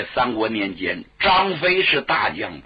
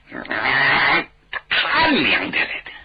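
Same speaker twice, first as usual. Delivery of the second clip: angry; slower than usual. Acted. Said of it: "圆睁火眼".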